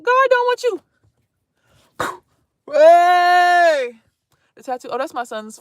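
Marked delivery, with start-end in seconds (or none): none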